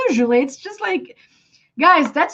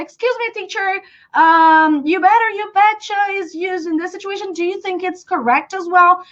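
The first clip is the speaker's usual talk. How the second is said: higher-pitched student cutting in; lightly acted student piping up; interrupting student; 7 semitones higher than usual. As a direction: interrupt the teacher with a question. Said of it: "like, interrupting me".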